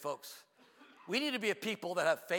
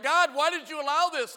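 mock-whining, a bit higher and louder; whiny complaint to God; 6 semitones higher, 5 dB louder than usual. Preacher mocking believers who blame God, in a whiny voice. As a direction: play the hurt believer blaming God.

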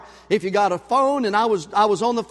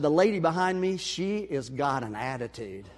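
confiding to cashier; 5 semitones lower, 6 dB quieter than usual. Retold or imitated. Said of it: "I said".

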